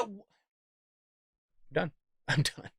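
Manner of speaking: whispered through a laugh; laughing give-up. Real, not acted. Vlogger ending the rant, laughing "I'm done". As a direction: laugh it off, out of steam.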